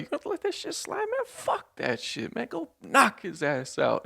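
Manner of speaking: high mocking egging-on voice; squeaky instigating friend voice; hyped-up friend. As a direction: goad your buddy into a fight. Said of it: "he's like".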